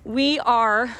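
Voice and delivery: normal talking voice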